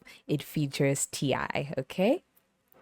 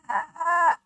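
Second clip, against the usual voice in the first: mock startled yelp; 13 semitones higher, 3 dB louder than usual. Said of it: "he goes".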